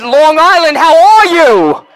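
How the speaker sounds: high call with a long drawn-out end; rousing crowd greeting. Real, not acted. Comic hailing Long Island a second time, rousing drawn-out call. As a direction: hail the audience, stretching the last words.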